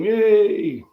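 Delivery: excited yay to viewers; cheer. Real, not acted. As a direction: celebrate the end of the stream. Cheering end of stream.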